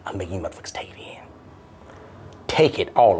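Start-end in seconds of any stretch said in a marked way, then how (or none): 0.1-1.2 whispered boast. Real, not acted. Boastful aside.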